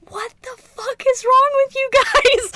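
high, loud mock outrage; utterly appalled. Fan voicing the Grim Reaper appalled at goofy adventurers, loud and aghast. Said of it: "he's just utterly appalled by them".

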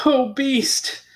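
high, tearful cry of relief; a light tearful sob of relief; sobbing. Acted. Beauty sobs over the waking beast, tearful.

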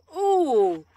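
sharp gasp into a high loud oh; startled gasp. Real, not acted. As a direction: gasp as if jolted by a screech. Startled by screech.

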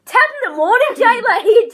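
high, loud Irish youtuber greeting; Jacksepticeye impression. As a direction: belt it like a hyper Irish youtuber. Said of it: "impressions or anything".

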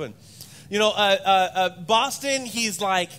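normal talking voice

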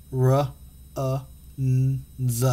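segment the word into its sounds; teacher sounding out runs for the class. Shown as a demonstration.

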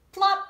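high, loud shovel sound effects; digging noises. Acted. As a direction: voice the spoon digging with playful noises.

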